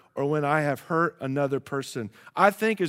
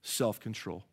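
ordinary talk

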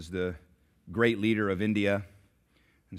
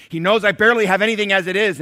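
outburst; 11 semitones higher, 16 dB louder, faster than usual. Acted. Beggar's outburst.

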